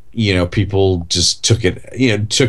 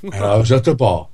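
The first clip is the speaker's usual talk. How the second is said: idle rambling in a mild Michael Caine voice; Michael Caine impression; 2 semitones higher than usual. Podcast host orders his last meal in a mild Michael Caine voice. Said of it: "I just have to talk like him".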